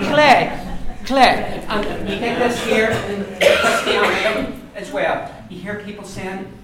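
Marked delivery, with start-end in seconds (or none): 0.1-1.6 clay with a slender front K; slender K demo. Shown as a demonstration. Teacher showing a hard word. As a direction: say clay with the tongue forward and loud. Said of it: "Do the ones that you can't say".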